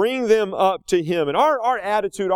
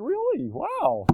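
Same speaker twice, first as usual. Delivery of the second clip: mock surprise; 11 semitones higher, 5 dB quieter, much slower than usual. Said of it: "I said".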